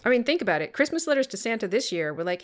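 usual speaking voice

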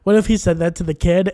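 ordinary talk

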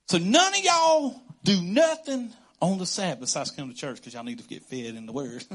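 ordinary talk

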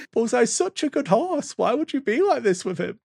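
high-pitched, wounded plea; imitated upset pleading. Dad retelling Toy Story scene, mock-distraught pleading. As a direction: plead like a heartbroken child.

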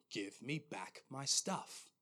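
slow, deliberate demand; firm and serious. Retold or imitated. Voice in a dream demands things back, slow and firm. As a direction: weigh each word as a firm command. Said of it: "quite firm and quite serious".

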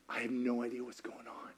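very soft, unvoiced confession; bewildered whisper. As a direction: whisper it, completely lost. Voicing Mary's confusion.

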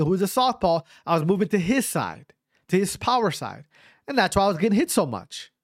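ordinary delivery

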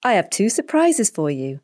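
plain reading voice

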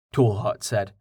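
ordinary delivery

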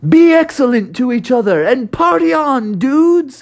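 high, hammed-up party-dude voice; high rocker-dude send-off, climbing at the end. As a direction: call it out like a stoked rock guitarist. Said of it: "they were like".

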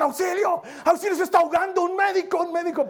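high, frantic mock shout for help; mock cry for help. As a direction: yell for a doctor in a hammed-up panic. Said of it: "empiece a gritar". (grito de auxilio exagerado y agudo)

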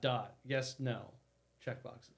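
usual speaking voice

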